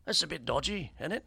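hammed-up English phrase; English accent. Shown as a demonstration. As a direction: put on a jokey English accent. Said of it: "that's my English accent".

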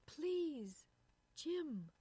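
anxious hushed plea. Acted. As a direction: plead with him to keep his voice down. Wife begs him to hush.

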